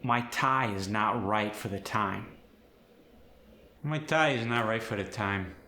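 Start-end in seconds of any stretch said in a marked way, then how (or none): 3.8-5.5 nasal, forward Fargo I sound; quicker Midwestern take, I pushed into the nose; Fargo accent. Shown as a demonstration. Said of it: "very far forward and nasally".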